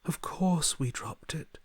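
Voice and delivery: hushed, slow whisper; a slow secretive whisper. Acted. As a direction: confess it under your breath.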